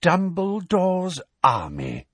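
slow, high, each word drawn out; quietly astonished. A narrator's reading. Fudge revealing the group's name, slow and high. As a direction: read the name out slowly in disbelief. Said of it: "said Fudge quietly".

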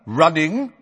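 high, loud, incredulous echo; sharp. A narrator's reading. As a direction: pounce on the wrong word. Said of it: "said Madam Bones sharply".

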